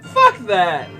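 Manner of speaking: ordinary talk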